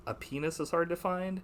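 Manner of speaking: mock French accent making "happiness" sound like "A penis". Quoting the actress's line to a friend, light French accent. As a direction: say "A penis is hard to find" with the h dropped, French style. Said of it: "she says it like".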